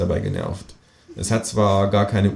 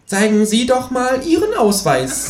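much higher cheeky kangaroo voice. Acted. Kangaroo sasses the policeman, high cheeky kangaroo voice. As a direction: sass the officer as the kangaroo. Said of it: "sagt das Känguru".

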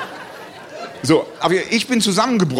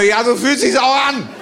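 calling back; 6 semitones higher, 3 dB louder, slower than usual. Retold or imitated.

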